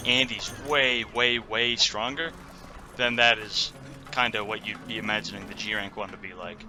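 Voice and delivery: ordinary talk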